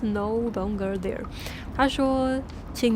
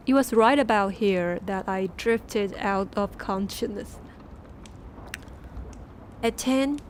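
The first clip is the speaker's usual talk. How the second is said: ordinary delivery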